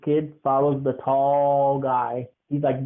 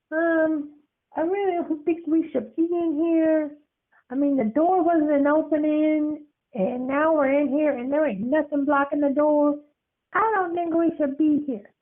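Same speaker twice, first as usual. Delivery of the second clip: put-on high anxious kid voice; a timid child pleading to leave; high, uneasy boyish voice; nervous little boy.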